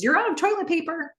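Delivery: high, quick acted announcement; announcing. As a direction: call it out high on the way out. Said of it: "she announces".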